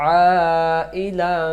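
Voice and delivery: long madd recitation; four-count stretch. Shown as a demonstration. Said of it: "চার আলিফ".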